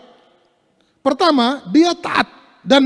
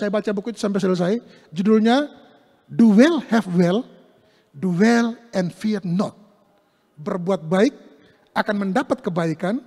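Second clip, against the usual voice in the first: ordinary delivery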